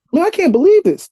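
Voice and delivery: retelling how starstruck he felt being trash-talked by a star; stunned excitement. Retold or imitated. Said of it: "So my brain is blown".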